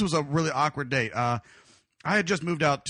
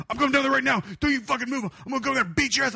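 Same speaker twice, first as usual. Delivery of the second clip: mock angry boyfriend, pitched up; worked-up threat, higher in pitch; retold threat; 7 semitones higher, faster than usual. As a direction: imitate a riled-up guy threatening over the phone. Boyfriend threatening over the phone.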